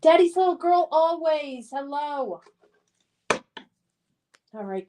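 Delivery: normal talking voice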